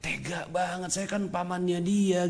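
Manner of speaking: menangis tertahan. Retold or imitated. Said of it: "Pas supir nangis dia netes air mata". (meniru lirih paman yang menangis)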